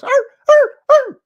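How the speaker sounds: excited yappy high barks; high-pitched bark. Retold or imitated. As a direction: bark like a happy puppy. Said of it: "it's high-pitched barks".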